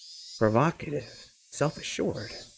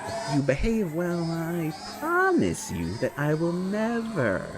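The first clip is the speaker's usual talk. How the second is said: higher, slow falsely gentle uncle; menacing promise; 7 semitones higher, slower than usual. Acted. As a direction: promise it sweetly, threat underneath.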